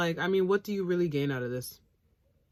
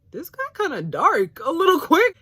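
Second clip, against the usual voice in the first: mock shocked; 10 semitones higher, 7 dB louder than usual. Viewer reacting, mock shocked.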